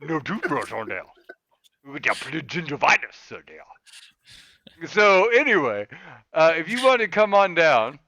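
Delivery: loud hammed-up Cajun announcement; Cajun accent. Host reading the community games notice in a Cajun accent, as asked. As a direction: read the notice like a bayou good old boy. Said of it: "now I'm doing it in my accent".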